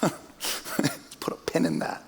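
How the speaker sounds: high, squeaky put-on voice, slowing to a breathy giggle; mock stalling reply. Pastor playfully stalling an eager churchgoer, high hammed-up voice. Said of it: "And I was like".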